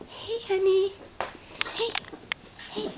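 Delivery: high cooing pet voice; squeaky praising a dog. Real, not acted. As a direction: coo sweetly at a puppy.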